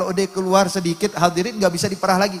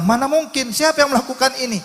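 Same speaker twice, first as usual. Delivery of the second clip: high, louder disbelieving question; incredulous; 10 semitones higher, 4 dB louder than usual. Retold or imitated. Husband doubts his wife's story, incredulous. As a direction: retell a husband's astonished disbelief.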